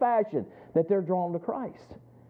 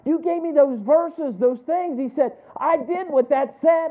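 higher and louder, pressing the point; eager explaining; 10 semitones higher, 6 dB louder than usual. Retold or imitated. The coworker excitedly reminds the speaker of the verses. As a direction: insist like a man who finally gets it. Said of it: "He goes".

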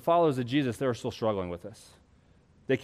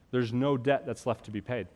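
normal talking voice

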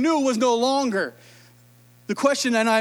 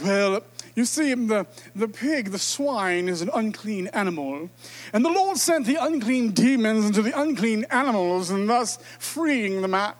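mock-learned pig explanation; pompous commentator; 2 semitones lower than usual. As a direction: explain it like a smug Bible scholar. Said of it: "Now we can be like".

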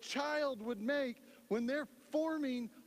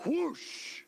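a sharp rising whoosh, louder; whoosh sound effect; 7 semitones higher, 4 dB louder than usual. Acted. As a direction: throw in a quick fire whoosh. Fire falls on the sacrifice.

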